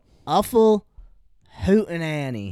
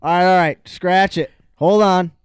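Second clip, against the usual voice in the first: loud, firm announcement; calling a halt; 8 dB louder, much faster than usual. Real, not acted. Host calls timeout on the spelling round, loud. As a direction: cut in loudly to stop everything.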